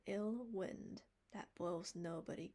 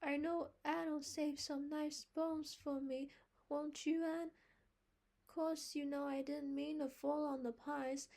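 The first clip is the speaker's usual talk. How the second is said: put-on high, loud coaxing boy voice; a boy wheedling Anne for leftover pie; wheedling little boy; 8 semitones higher, 6 dB louder than usual. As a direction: coax Anne like a cheeky child. Davy's child voice.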